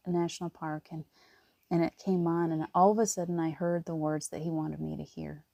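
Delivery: ordinary delivery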